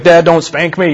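lightly voiced kid pleading for all to hear; child's loud plea. Child to dad in a crowded Walmart, mock loud plea. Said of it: "as loud as everybody can hear you".

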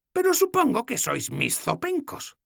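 voiced old woman, fondly exasperated; old goddess, fond scolding. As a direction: grumble with affection. Logna voiced.

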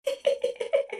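spiteful ghostly snicker; a nasty little whispered cackle; malicious giggle. A narrator's reading. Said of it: "злобное хихиканье".

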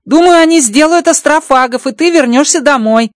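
high, bright-pitched alien voice, amazed; Rocky's alien voice. Acted. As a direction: play the little alien engineer, delighted. Rocky promises care.

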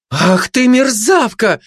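high, slow cry of outrage; indignant. A narrator's reading. Gossip robbed of meat by glutton, indignant. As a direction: draw out the outrage at a high pitch. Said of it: "справедливо вознегодовала сплетница".

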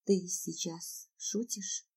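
very soft, slow, shocked question; hushed, quavering disbelief; trembling voice. Acted. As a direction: ask it slowly, hardly daring. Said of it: "спрашиваю вибрирующим голосом".

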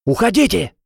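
high, urgent command; urgent yell. A narrator's reading. Gron shielding the girls from flying chair, yelling. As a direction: call out to get them away. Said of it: "гаркнул Грон".

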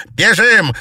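very loud, high cry; urgent shout. A narrator's reading. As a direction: yell to flee. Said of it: "крикнула Феофания".

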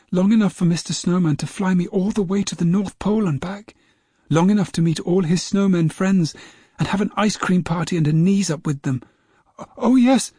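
put-on a boy bursting with joy, no stutter; young James, happy. James tells grandma his adventure.

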